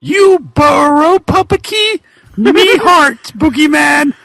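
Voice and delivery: high, hammed-up vamp voice; raspy sultry villainess. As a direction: purr it like a bat-winged seductress. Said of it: "in a voice raspy with sexuality and hate".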